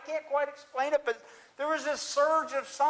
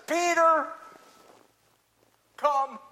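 Jesus calling; 4 semitones higher, 7 dB louder, slower than usual. Acted. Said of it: "Jesus doesn't always use a lot of words".

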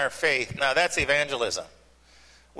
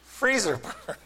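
mock-bothered repeat; 4 semitones higher, slower than usual. Shown as a demonstration. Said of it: "That one bothers me".

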